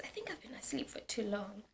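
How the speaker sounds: whispered, dawning worry; hushed realisation. Retold or imitated. Student waking too late to herself, hushed realisation. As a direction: drop to a soft whisper as it sinks in.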